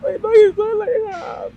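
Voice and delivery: ordinary delivery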